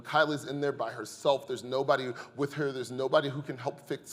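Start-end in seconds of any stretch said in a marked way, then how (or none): none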